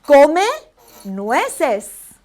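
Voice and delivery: deliberate teacher model; slow Spanish drill. Shown as a demonstration. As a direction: Come loud and rising, nueces falling. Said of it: "let's go over the word eat walnuts".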